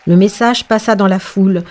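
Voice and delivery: ordinary delivery, nothing marked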